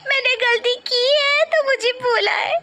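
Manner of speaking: high, loud sobbing self-blame; crying. Acted. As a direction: wail through tears, breaking into a cry. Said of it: "तू क्यों रो रही है". (रोते-रोते ज़ोर से गलती मानती बहू)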